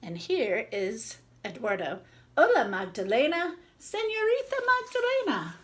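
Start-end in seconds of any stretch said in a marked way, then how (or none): 2.4-5.6 higher, sing-song friendly greeting; cheerful shopkeeper greeting. Acted. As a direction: greet her warmly like a jolly grocer.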